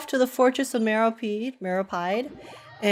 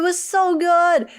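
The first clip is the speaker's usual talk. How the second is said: slow, drawn-out and higher; gushing; 8 semitones higher, slower than usual. Real, not acted. Streamer telling a viewer how great the show was, gushing. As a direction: savor every word of the praise.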